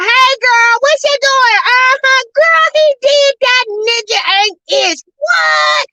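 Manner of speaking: high, loud, hammed-up gossip call; squealing mock phone call, loud and high; loud phone chatter. Shown as a demonstration. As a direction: gossip on the phone for the whole lobby to hear. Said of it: "I wasn't talking like that".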